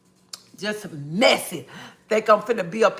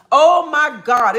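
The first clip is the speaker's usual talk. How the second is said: 1 exasperated; 5 semitones higher, 7 dB louder, slower than usual. Real, not acted. Exasperated outburst.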